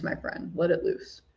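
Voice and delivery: soft whispered repeat, almost breathless. Real, not acted. To a viewer inviting a heartfelt question, whispered. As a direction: drop to a whisper for the echo.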